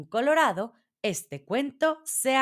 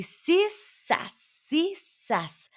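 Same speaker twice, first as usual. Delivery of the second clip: slow, high swishing chant; spinning sound effect; 8 semitones higher, much slower than usual. A narrator's reading. Dwarf spinning straw into gold, drawn-out spinning sound. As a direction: draw out the whirr of the wheel. Spinning wheel noise imitated.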